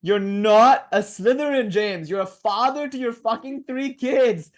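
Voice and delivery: barely holding in laughter; laughing. Real, not acted. Retelling friend's joke, cracking up.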